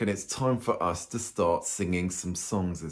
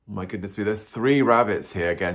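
ordinary delivery

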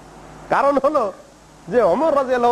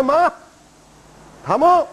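retold command to stop; 6 semitones higher, slower than usual. Prophet halts the angry companions.

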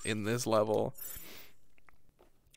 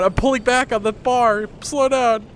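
louder, higher frantic pleading; panicked plea; 8 semitones higher, 4 dB louder than usual. Real, not acted. Pleading with runaway game vehicle.

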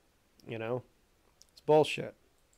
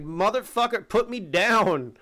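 angry turtle voice; 6 semitones higher, 6 dB louder, slower than usual. Pretend. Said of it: "he's probably thinking".

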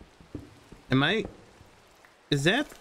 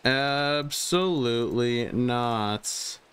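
Absolutely not, each syllable slowly stretched; very slow, flat-out refusal; slow firm refusal; much slower than usual. Real, not acted. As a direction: drag out Absolutely not with finality. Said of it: "repeated slowly".

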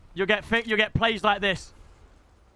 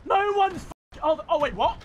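high startled yelp; high-pitched panic when shot; panicked shock; 11 semitones higher than usual. Real, not acted. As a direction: blurt it out in alarm. Panic shock during fight.

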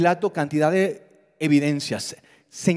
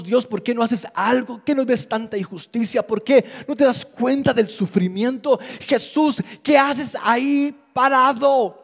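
complaining to God; 6 semitones higher than usual. Retold or imitated.

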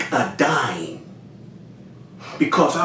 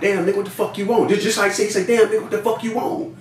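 higher-pitched mock exasperation; retold irate demand; 6 semitones higher than usual. Homeboy calling back about a bad deal, retold irate demand. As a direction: raise the pitch in mild indignant complaint.

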